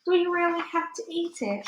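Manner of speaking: put-on little girl Sophie. A narrator's reading. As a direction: lift the voice high like a child. Sophie asking.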